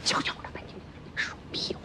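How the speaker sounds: hushed, whispery scolding; very soft, irritated mutter; muttered rebuke. Acted. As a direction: hiss a rebuke under your breath. Mother rebukes son under breath.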